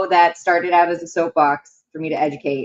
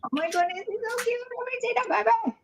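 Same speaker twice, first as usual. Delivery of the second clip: very high soft cooing; 7 dB quieter, faster than usual. Real, not acted. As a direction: gush softly over a cute puppy. Cooing over the dog.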